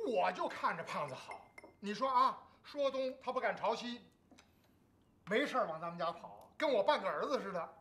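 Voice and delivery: usual speaking voice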